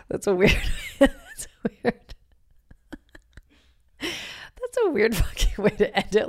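giggling through the words; real amused laughter; laughing. Real, not acted. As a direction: laugh as you try to say it. Laughing at her own ending.

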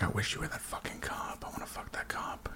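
breathless whispered taunt; mock whisper. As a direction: whisper the jab to wound. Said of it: "She's like".

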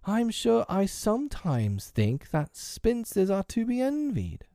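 slow, high-pitched lament; harassed mother's high complaint; crying out. A narrator's reading. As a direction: sigh over your lot, slow and high. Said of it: "cried Wendy".

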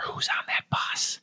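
hushed, unvoiced whisper; retold whisper. Woman whispers in a comedian's ear by his bus, whispering. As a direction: whisper it slowly, imitating a secretive stranger. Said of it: "she whispers in his ear".

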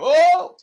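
high, loud yelp of alarm; a sudden shriek as she tips; startled cry. Retold or imitated. Imitating a woman toppling off a truck, shrieking. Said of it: "they go".

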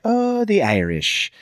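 slow, lilting cartoon Irish accent; cartoonish Irish. Shown as a demonstration. Showing his go-to impression, cartoonish Irish accent. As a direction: stretch it out in stage Irish. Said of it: "this is more of a cartoonist Irish".

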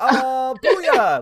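very high, loud mock cheer; over-the-top gloating cry; triumphant taunt. Shown as a demonstration. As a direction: whoop it like you just won. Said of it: "that's pretty much what it means".

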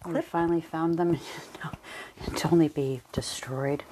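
hushed whisper. Real, not acted. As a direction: whisper it dramatically. Whispered joke over mishap.

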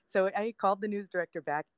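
normal talking voice

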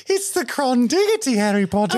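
high, squeaky elf sales pitch; Dobby impression. Dobby pitching weed to Harry.

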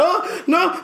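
high "oh no" through laughter; laughing dismay. Retold or imitated. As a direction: laugh at your own mistake. Her own realization retold.

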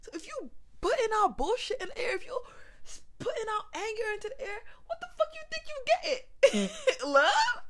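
high, loud, laughing outburst; laughing rant. Real, not acted. Speaker rants about putting anger out, laughing loudly. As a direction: crack up while ranting.

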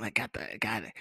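normal talking voice

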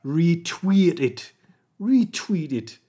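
slow, drawn-out Geordie "Retweet it"; Geordie accent. Shown as a demonstration. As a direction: practise the Geordie vowel in "Retweet", stretching it. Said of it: "good way to get a hook on the Geordie accent".

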